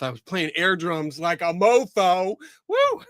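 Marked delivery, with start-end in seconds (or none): 2.7-3.0 high, happy yelp; excited whoop. Real, not acted. Host whooping at viewers after air drums. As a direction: let out a quick cheer, pitched way up.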